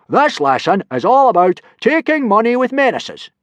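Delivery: high, loud teacher yell. Acted. Gobber yelling the lesson to the boys, high and loud. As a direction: announce it to the whole class. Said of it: "yelled Gobber".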